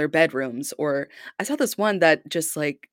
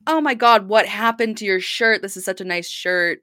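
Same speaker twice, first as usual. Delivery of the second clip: higher, louder mom feigning dismay; mock shock; 5 semitones higher, 4 dB louder than usual. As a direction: play the horrified mom, hammed-up. Acting out a mom's shock.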